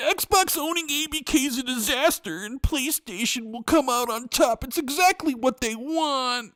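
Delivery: high, slow whiny fanboy. Retold or imitated. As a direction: whine it like a sulky fanboy. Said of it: "whiny fan, fanboy voice comes out".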